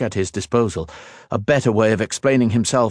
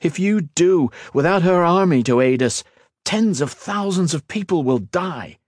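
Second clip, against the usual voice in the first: high, urgent warning; dramatic warning; 9 semitones higher than usual. A narrator's reading. Ethan warns her of mass deaths, dramatic and urgent. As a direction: warn with desperate intensity. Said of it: "His dramatic words".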